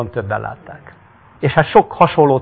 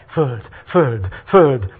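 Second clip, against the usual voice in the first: stammering; much slower than usual. Acted.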